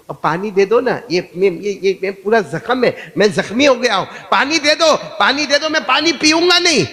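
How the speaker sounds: high pleading, building to a loud cry; acted pleading. Preacher acting a wounded man asking for water, hammed-up pleading. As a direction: beg with mounting urgency.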